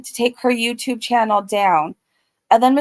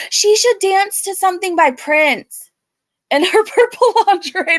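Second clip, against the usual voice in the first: laughing; 8 semitones higher, 3 dB louder than usual. Real, not acted.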